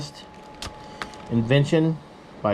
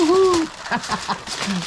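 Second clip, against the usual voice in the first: excited. Real, not acted. Rolling the food parcel.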